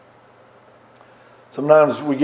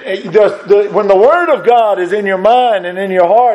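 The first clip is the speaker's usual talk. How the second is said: fervent preaching; 5 semitones higher than usual. Acted. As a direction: call out each fruit of the Spirit. Lists fruits of the Spirit.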